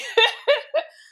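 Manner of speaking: a short high burst of laughter. Real, not acted. Laugh after painful admission.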